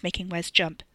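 ordinary delivery, nothing marked

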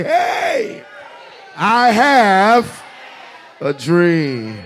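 high, loud yell across the room. Shown as a demonstration.

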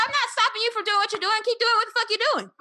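high, loud, rapid-fire retort; yelled comeback. Retold or imitated. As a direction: yell back defiantly, retelling a row. Retelling shouted comeback to stranger.

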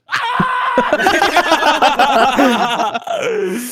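high shouted laughter; loud laugh. Real, not acted. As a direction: burst out laughing loudly. Big laugh after story.

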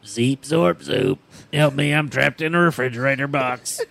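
kid playing robot. Kid in a fridge box, mock robot, then a light plea for help. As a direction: beep out the robot words, then plead mildly. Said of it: "pretending to be a robot".

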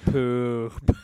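"Poop" loud and drawn out on the oo; exaggerated "poop". Shown as a demonstration. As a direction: purse the lips and push out a long "Poop". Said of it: "exact same motion that your butthole makes".

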